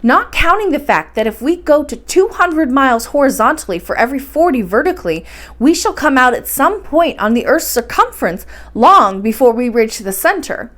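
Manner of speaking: ordinary delivery, nothing marked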